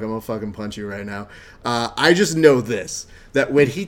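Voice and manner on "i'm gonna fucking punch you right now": mock threat. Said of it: "I'd be like".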